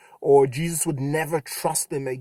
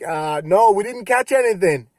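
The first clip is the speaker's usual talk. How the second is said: calling back; 5 semitones higher, 9 dB louder than usual. Retold or imitated. Disciples calling back from boat.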